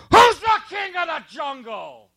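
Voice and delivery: high, puffed-up lion voice; swaggering lion. Pretend. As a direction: strut and demand it like a bully lion. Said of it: "and he goes".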